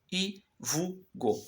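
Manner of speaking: ivugo said slowly, each syllable clear; careful reading. Shown as a demonstration. Teacher reading the word ivugo slowly to students. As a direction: read ivugo out slowly for the class. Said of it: "Aqui no finalzinho não vai ter som".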